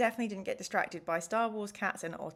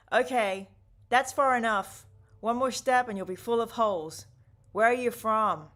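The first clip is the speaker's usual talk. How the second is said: loud, firm warning shout; shouted challenge; 7 dB louder than usual. Acted. Unseen voice challenges travellers rounding the corner, shouting. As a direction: call out a threat to halt a stranger. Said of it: "then a voice sh-, shouts out".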